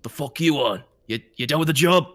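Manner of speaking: gruff, irritated boss voice; annoyed Boris. Acted. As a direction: snap at the intruder. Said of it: "he's like".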